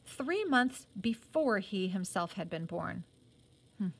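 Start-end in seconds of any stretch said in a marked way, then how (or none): none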